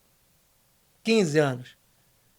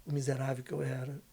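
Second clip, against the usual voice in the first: father's soft confession; 6 semitones lower, 10 dB quieter than usual. Retold or imitated. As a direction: quietly voice the father asking forgiveness. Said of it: "ele olhou dentro do meu olho e falou assim". (confissão baixa do pai, em voz contida)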